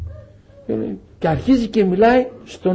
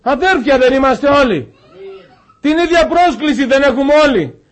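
high, raised preaching voice, urgent; 9 semitones higher, 4 dB louder than usual. Acted. Preacher urging the congregation back to its purpose, raised and urgent. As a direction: exhort the brothers with rising urgency. Preacher exhorts to call brothers.

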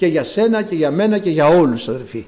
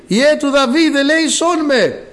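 high pleading cry for mercy, a little louder; calling out for mercy; 7 semitones higher, 3 dB louder, slower than usual. Retold or imitated. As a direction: call out to the passing master, begging. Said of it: "και άρχισε να φωνάζει". (έκκληση για έλεος, ψηλή φωνή)

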